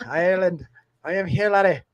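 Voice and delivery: attempted Irish drifting into Scottish; playful Irish-Scottish accent. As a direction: try an Irish brogue, landing nearer Scottish. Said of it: "All right, where's Irish?".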